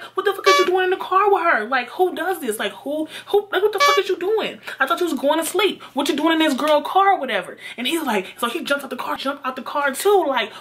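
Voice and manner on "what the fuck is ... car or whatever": high-pitched indignant grilling; retold outraged telling-off; angry confrontation. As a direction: fire accusing questions, acting out the fight. Acted quarrel with cheating boyfriend.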